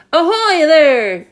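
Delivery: loud hail from the rooftop; police chief hailing the peach; shout. A narrator's reading. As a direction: call it out big and slow. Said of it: "shouted the chief of police".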